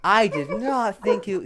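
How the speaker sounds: high, loud and slow in disbelief; high, loud, amazed; stunned. Acted. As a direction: still reeling from the shock. Gondo stunned by the vein.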